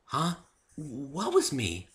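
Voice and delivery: high, small and hesitant; timid. A narrator's reading. Fluttershy asks Dave timidly, high and hesitant. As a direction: ask shyly, unsure what he means. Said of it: "she asked timidly".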